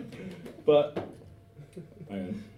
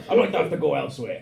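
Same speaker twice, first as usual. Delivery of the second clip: put-on Welsh accent; 4 semitones higher, 5 dB louder than usual. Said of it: "I'm gonna do it in that fucking hot shit Welsh voice".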